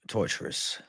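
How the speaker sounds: "Torturous" breathed out in a whisper. Real, not acted. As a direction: drop it to a whisper, drained. Whispered repeat after a pause.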